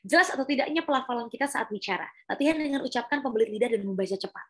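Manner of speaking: rapid, clearly articulated read-aloud; fast reading. Shown as a demonstration. Coach demonstrating tongue exercise from the screen, fast clear reading. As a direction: read it quickly, keep every sound clear. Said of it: "dibacain dengan cepat".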